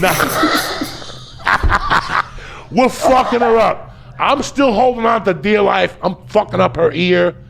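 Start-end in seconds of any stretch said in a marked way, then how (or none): 0.2-2.7 wheezing laugh. Real, not acted. Laughing at the brawl.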